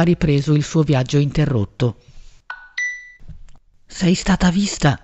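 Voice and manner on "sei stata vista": ordinary delivery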